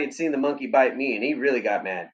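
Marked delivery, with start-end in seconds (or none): none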